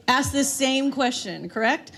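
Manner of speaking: ordinary talk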